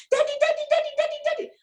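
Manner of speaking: very high, loud imitation of excited kids; excited children. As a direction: squeal it like children mobbing their father. Acted children greeting tired parent home.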